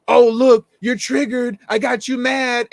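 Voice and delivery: high, loud mocking "oh look" taunt. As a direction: mimic a troll gloating. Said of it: "she turns around and goes".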